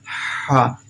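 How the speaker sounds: Ha breathed from the middle of the throat; Arabic letter Ha. Shown as a demonstration. Teacher showing students the throat letter: breathy Ha. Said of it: "create Ha sound".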